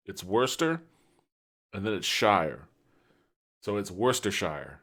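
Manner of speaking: Worcestershire broken up: wuss-ter, then shire; syllable by syllable. Shown as a demonstration. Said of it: "Let me tell you how it's pronounced".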